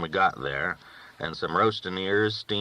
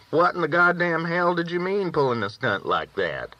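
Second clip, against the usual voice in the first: mock angry outburst; 9 semitones higher than usual. A narrator's reading. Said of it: "if I had done it".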